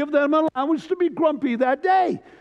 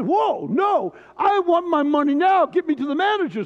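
put-on grumpy old man shouting; 5 semitones higher than usual. Grumpy winner refusing the clerk, shouting high.

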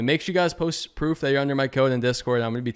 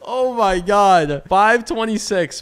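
high, loud laughing cheers; excited; 12 semitones higher, 6 dB louder, slower than usual. Real, not acted. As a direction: shout out the big number. Huge multiplier win.

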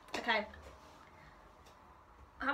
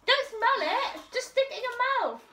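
urgent urging; 8 semitones higher than usual. Real, not acted. Urging friend to eat bean.

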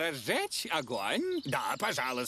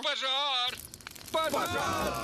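high alarmed yells; shouting fire; 9 semitones higher, slower than usual. Acted. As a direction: cry out the alarm. Папа кричит о пожаре. (крик о пожаре)

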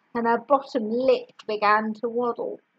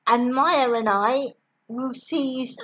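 plain reading voice

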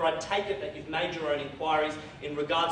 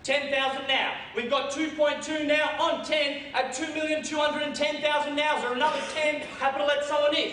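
auctioneer calling; 10 semitones higher, 3 dB louder than usual. Acted.

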